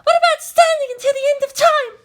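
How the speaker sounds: put-on a distraught young woman, pitched high; Maya voice. Maya begging Shinji to explain, high and pleading.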